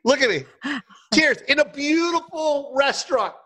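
high-pitched hammed-up lament; mock anguish. Comic retelling a kangaroo dinner to host, mock anguish. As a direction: wail it a little, high, for comic effect. Replaying distress at eating kangaroo.

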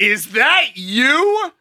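loud, slow, high call down the aisle; drawn out and loud, turning heads. Retold or imitated. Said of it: "'Cause I said it very loudly".

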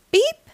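very high short beep; little rising toot; high-pitched small horn; truck horn beep. A narrator's reading. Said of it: "Blue said".

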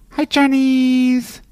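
very high, slow cooing call; dog-talk voice. Shown as a demonstration. Calling the dog Charlie in a squeaky pet voice. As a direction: coo it up at the dog like baby talk. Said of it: "That's how I talk to my dog".

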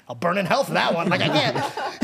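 imitated dad straining not to yell; held-back fury. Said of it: "wanna yell at you but can't".

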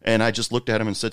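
usual speaking voice

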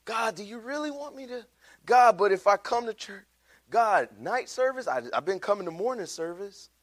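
high-pitched, mock-reluctant protest; making excuses. Believer making excuses to God about church, high reluctant pleading. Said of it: "The excuses".

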